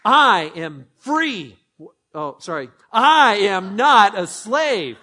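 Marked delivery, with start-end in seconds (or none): 0.0-1.6 much louder, very slow; loud declaration. Shown as a demonstration. Pastor leading a call-and-response, loudly. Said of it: "I want you to say it". 2.9-5.0 much louder and slow; loud declaration. Shown as a demonstration. Pastor leading the church in a loud proclamation. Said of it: "I want you to say it".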